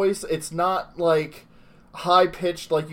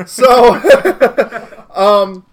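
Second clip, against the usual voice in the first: laughing; 6 semitones higher, 12 dB louder than usual. Real, not acted. Laughing at own nosebleed.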